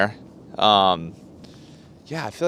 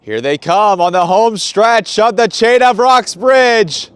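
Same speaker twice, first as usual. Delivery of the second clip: loud, high, slow race announcer; 10 semitones higher, 9 dB louder, slower than usual. Pretend. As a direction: call the finish like a big event announcer. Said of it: "Working on my announcer voice".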